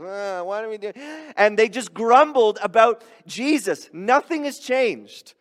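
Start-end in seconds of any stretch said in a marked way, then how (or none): 0.0-0.9 put-on grumbling kids. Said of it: "what my kids sound like to me".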